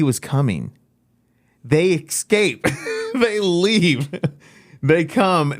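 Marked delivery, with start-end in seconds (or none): 1.6-5.5 laughing in disbelief. Real, not acted. Amazed telling of lepers escaping.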